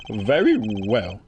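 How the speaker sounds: slow, drawn-out suspect voice with a chuckle; slow theatrical voice. Acted. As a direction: draw out every word like a scheming suspect.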